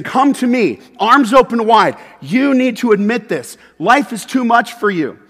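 urgent call. Acted. Jesus calling, arms open.

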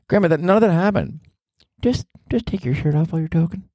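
high put-on voice answering grandma; a playful high comic voice; hammed-up high voice; mock granny banter. Talking to Grandma character, far high.

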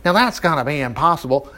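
usual speaking voice

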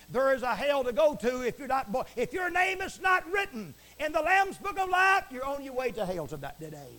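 warning of hell, rising to a shout then dropping back; fiery preaching. Acted. Preacher warning the broadcast audience, rising to a shout.

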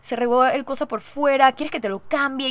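a lightly voiced embarrassed clerk; embarrassed server. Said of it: "estaba todo así como apenado".

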